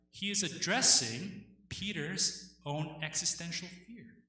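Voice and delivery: ordinary talk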